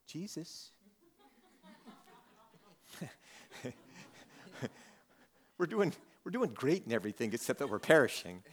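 soft, hesitant, mock-casual report; mock-polite understatement. Disciples gently waking Jesus with bad news, mock-polite. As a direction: play down the emergency, meekly and lightly. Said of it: "What do you think that sounded like?".

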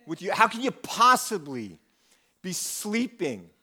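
upset. Acted. Panicked disciples rebuking sleeping Jesus in the storm, upset. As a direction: hit the key words hard, drawn out in disbelief. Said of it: "it seems like they're upset".

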